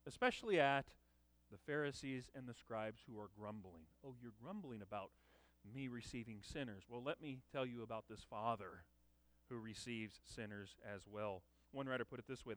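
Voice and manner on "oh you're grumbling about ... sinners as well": softer, lower, knowing retort; a hint of Jesus gently needling the grumblers; quiet pointed reply. Jesus to the grumbling Pharisees, soft and pointed.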